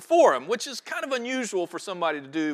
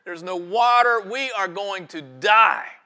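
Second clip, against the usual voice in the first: high, loud, drawn-out panicked yelling; yelling and screaming; 5 semitones higher, 5 dB louder than usual. Acted. Desperate shipwrecked man to his companion on the island, acted yelling and screaming. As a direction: play a castaway screaming in desperation. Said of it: "began yelling and screaming".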